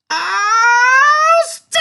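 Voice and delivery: piercing, very high, drawn-out scream; child's yell. A narrator's reading. Tia is picked up by the hair, shrieking yell. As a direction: scream it out, stretching both words. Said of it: "Tia yelled".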